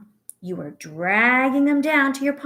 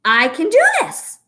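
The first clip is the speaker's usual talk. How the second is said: high, loud, eager self-cheer; excited I can do this; 11 semitones higher, 8 dB louder than usual. Acted. Pretend child on the first school day, eager and loud. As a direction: say it bursting with confidence.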